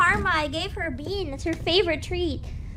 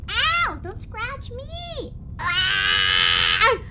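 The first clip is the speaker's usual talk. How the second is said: higher sudden ow; pained yelp; 7 semitones higher, 5 dB louder, much slower than usual. Real, not acted. Owner yelping as the dog scratches her. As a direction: react to a scratch.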